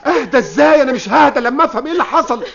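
indignant. Acted. Father snaps at the officer who says calm down, heated.

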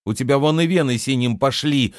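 ordinary delivery, nothing marked

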